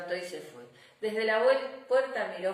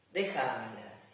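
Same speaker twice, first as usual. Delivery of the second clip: grandpa voice; 8 semitones lower, 4 dB quieter, slower than usual. Acted. Grandpa answers the girls.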